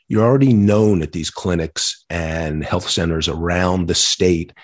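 ordinary talk, nothing marked

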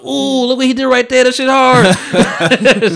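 high, loud, hyped-up reaction; hype man gushing. Retold or imitated. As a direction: gush over it like a hype man. Reenacts hyping the drawing to customers.

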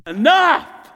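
acted high, loud outburst; fed-up shout. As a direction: burst out as if you've had enough. Acts out own past outburst.

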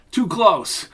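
startled. Acted. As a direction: react to a near miss. Bullet whizzes overhead.

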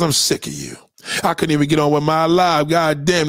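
normal talking voice

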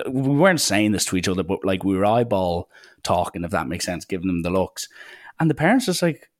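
ordinary delivery

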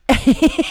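loud high buzzing noise imitating a bee; sudden loud bee buzz; bee buzz imitation. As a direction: buzz like an angry bee up close. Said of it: "and doing this".

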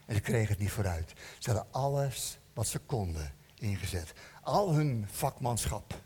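low, hushed storytelling with long pauses; solemn story narration. A narrator's reading. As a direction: set a stormy night scene, low and measured. (ingetogen, lage verteltoon)

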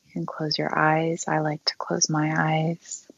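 unhurried meditation guide with long pauses; guided meditation. Acted. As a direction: guide them gently through breathing, leaving long silences.

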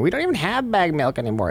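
high, whiny complaining voice; complaining Manitoban. Retold or imitated. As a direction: whine it like an annoyed local. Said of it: "people from Manitoba going".

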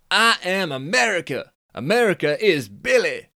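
high, loud Californian dude scoff. Acted. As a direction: squeak up the pitch like a cocky Californian. Billy boasting.

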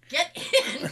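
laughing. Retold or imitated. Laughing, retelling the getaway.

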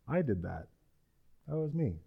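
softly voiced humblebrag. Saul quietly crediting himself for the victory, softly boastful. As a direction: drop the volume for a mock-modest boast. Mimics Saul's false modesty.

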